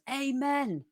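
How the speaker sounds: high, drawn-out mock roar in a recital; roared amen. A narrator's reading. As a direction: call it out high, like the loudest response in the room. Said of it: "Timothy Winters roars".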